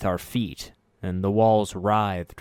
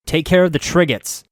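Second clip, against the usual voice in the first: high, loud shout to the companions; crying out; 9 semitones higher, 9 dB louder than usual. Acted. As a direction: call across the battle. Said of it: "I cried to the others".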